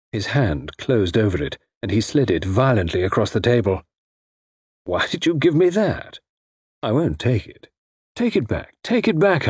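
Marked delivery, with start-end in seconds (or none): 4.9-9.5 mock-angry, pitch jumping up on take it back; angry refusal. A narrator's reading. Gigolo refusing her gift, with mild anger. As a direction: push the gift away, a rising heated protest. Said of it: "He was angry".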